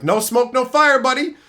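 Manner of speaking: loud, high cocky comeback; cocky quip. Retold or imitated. Brushing off the manager about smoking, cocky. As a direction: toss it off smugly. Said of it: "I was like".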